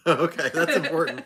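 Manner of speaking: laughing out the words; talking through laughter. Real, not acted. Laughing at a joke.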